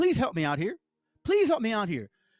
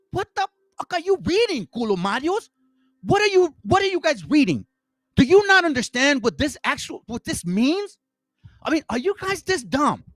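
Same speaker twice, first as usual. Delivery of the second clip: exasperated outburst; 6 semitones higher than usual. Real, not acted. Streamer scolding chat for misreading the book, exasperated and raised. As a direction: fire it at the chat in disbelief. Outraged at chat's reading.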